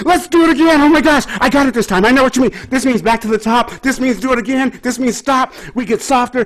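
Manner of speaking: high, bright, eager mock student voice. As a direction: imitate thrilled students who finally got it. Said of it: "they're just like".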